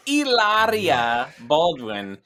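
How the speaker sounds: mock Spanish pronunciation. Host announcing the celebrity's return, in mock Spanish accent. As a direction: announce it with a slow Spanish flourish.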